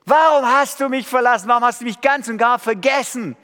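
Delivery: high, loud, crying out to God in despair; a psalmist wailing at a silent God; a desperate shout into the dark, pleading and accusing; anguished lament. Acted. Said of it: "Lobpreis im Sinne vom Alten Testament geht so".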